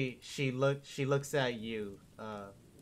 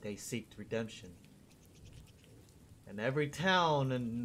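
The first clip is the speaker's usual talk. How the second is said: smooth excuses from a schemer; calm crime boss; 6 dB quieter than usual. Acted. DM voices Gilmore defending himself.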